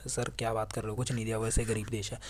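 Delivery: muttered student aside, lightly voiced; student softly scoffs that Nepal gave nothing; scoffing student.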